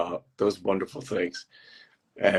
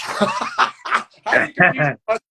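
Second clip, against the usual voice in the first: high, loud, incredulous laughter; real laugh breaking into the words; laughing at himself; 11 semitones higher, 5 dB louder, slower than usual. Real, not acted. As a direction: crack up over your own blunder. Laughs at own mix-up.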